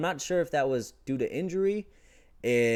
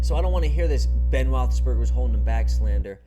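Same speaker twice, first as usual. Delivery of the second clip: frustrated loud rant; 3 semitones higher, 9 dB louder than usual. Real, not acted. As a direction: let the frustration blow up loud. Fan rant about the offense.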